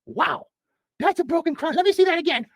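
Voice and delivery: high falsetto comic nurse; busy, high-pitched nurse patter; nurse character. Acted. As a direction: fuss over the patient in a high voice. Plays nurse treating Jack.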